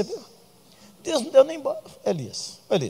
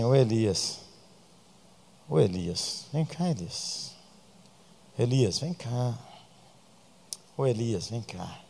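gentle call; 4 semitones lower, 10 dB quieter, slower than usual. Retold or imitated. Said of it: "Deus chegou lá e falou assim".

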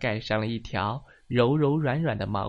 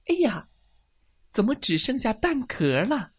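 high startled cry and question; acted shock; 10 semitones higher, 4 dB louder than usual. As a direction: gasp out alarm at the discovery. Hen finds only an eggshell.

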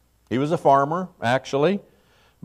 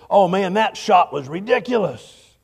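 mock sports commentator; 5 semitones higher than usual. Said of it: "The commentators will say".

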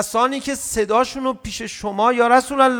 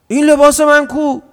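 loud, higher, rude demand; child yelling; 8 semitones higher, 9 dB louder than usual. Retold or imitated. Child shouting at his mother, loud and rude. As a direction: yell it like a spoiled child.

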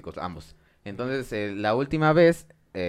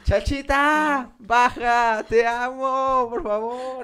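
high, very slow, wailing lovesick cry; hammed-up sobbing serenade; a jilted lover's high wail under the window; mock wailing plea; 12 semitones higher, 4 dB louder, much slower than usual. Acting his crying serenade.

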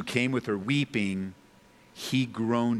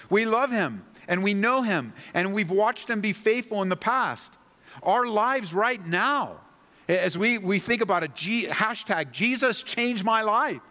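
higher and louder, building sermon fervour; preaching at full force; 8 semitones higher, 3 dB louder than usual. Acted. Preacher rousing the congregation about God's power, higher and louder. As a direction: drive the point home to the congregation.

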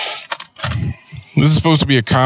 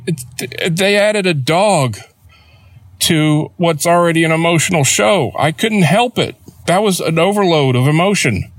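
retold teary pleading; 6 semitones higher than usual. Explaining tears to his wife, higher teary whine. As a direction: plead like a man caught crying. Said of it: "And I'm sitting there crying".

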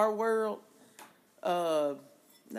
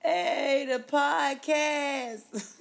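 drawn-out; 6 semitones higher, much slower than usual. Acted. Repeats handle drawn out.

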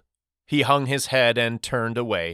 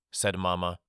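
ordinary delivery

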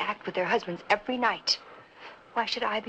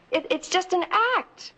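high pleading; 9 semitones higher than usual. Acted. Pleading with Vito, voice rising.

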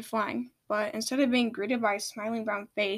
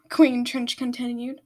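ordinary delivery, nothing marked

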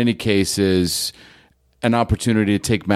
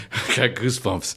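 laughing; 5 dB quieter than usual. Real, not acted. Moved, laughing at the story.

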